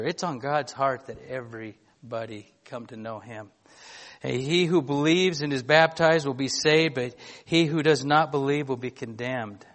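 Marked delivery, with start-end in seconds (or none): 4.2-9.6 louder, measured reading of the Bible passage; scripture reading. Acted. Pastor reading scripture to his church, louder and solemn. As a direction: read the Gospel out to the congregation with weight. Preacher reads Mark passage aloud.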